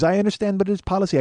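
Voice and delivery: put-on high Indian-accented clerk. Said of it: "he's got that, uh, accent".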